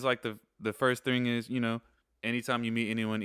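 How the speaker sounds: normal talking voice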